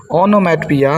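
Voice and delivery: ओनोमैटोपिया ending in a plain "pi-ya"; correct pronunciation. Shown as a demonstration. Teacher modelling the term for students, ओनोमैटोपिया ending "piya", no "o". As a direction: say ओनोमैटोपिया as "ono-mat-piya". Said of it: "इसका correct pronunciation क्या है".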